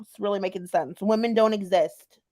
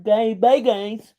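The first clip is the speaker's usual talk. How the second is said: mocking wimpy voice. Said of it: "how unscary can you be?".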